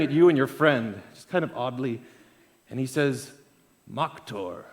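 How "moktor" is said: lightly voiced Viking barkeep, low and soft; a low-pitched hint of a Viking bartender. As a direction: say it low, as a burly barkeep would. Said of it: "bartender who is definitely a Viking".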